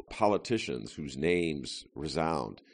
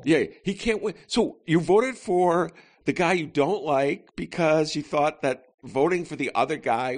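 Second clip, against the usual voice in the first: high, loud mock disbelief; incredulous mockery; 11 semitones higher, 5 dB louder than usual. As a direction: scoff at the voter's logic. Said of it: "That's my absolute favorite".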